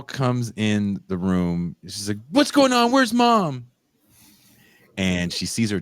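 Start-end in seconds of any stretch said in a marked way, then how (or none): 2.3-3.7 put-on high, loud, worried girl calling out; a lightly mocking panicked daughter voice. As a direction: play the frantic daughter bursting in.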